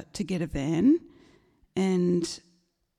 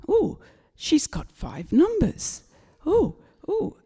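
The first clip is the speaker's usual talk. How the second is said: rising, higher gasps of amazement; amazed excitement; 8 semitones higher, 3 dB louder, slower than usual. Retold or imitated. Dad checking mum's ticket, growing excited. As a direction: let the excitement climb as it sinks in. Dad sees five numbers.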